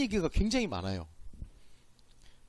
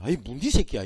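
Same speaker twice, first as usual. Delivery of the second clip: Gyeongsang dialect; 6 semitones lower than usual. Retold or imitated. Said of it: "이거는 욕이 아닙니다".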